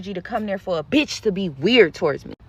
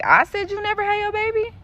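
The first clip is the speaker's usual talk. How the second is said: incredulous retort; 12 semitones higher than usual. Retold or imitated. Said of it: "I'm like".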